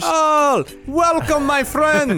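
high, hammed-up Hawaiian island accent. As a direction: welcome them like a Hawaiian islander. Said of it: "uh, Hawaiian accent".

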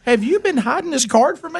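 high hammed-up accusing wife; imitating wife. Husband imitating wife asking about the hidden card, high mock accusation. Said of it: "She goes".

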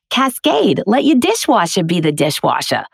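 Queens-accented commercial read. Shown as a demonstration. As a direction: read the Cascade spot in a thick Queens accent. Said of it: "do a take like this".

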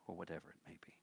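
quick conspiratorial whisper; whispered aside. Acted. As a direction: let them in on a secret. Playful aside about long sermons.

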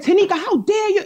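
high-pitched, indignant imitation of screaming; retold scream. Woman retelling an angry phone call to a judge, mock scream. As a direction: imitate her screaming at you, pitched well up. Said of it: "She screamed".

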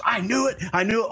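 high-pitched thrilled cop; excited fan. Retold or imitated. Cop recognising a famous wrestler, excited fan. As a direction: blurt it like a fan proven right.

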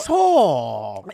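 loud, drawn-out insult; mock indignant yell. Buddy objecting to the impression, mock indignant yell. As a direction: stretch it out loud in mock outrage.

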